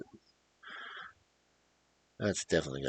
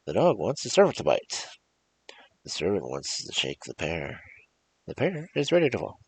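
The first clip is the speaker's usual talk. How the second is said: lively storybook read-aloud, louder on each new character; story narration; 5 semitones higher, 5 dB louder, faster than usual. Acted. Reading a folk tale to viewers, lively storybook narration. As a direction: tell the cumulative tale to listeners, building each line. Retakes the tale's ending.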